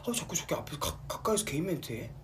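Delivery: half-hearted pouty jealous fan. Retold or imitated. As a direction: grumble jealously from the upper floor. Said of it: "약간 이런 느낌".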